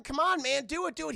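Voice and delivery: mock schemer pushing someone into a fight; egging on. Voicing the manipulator to Cold Stone, urgent goading.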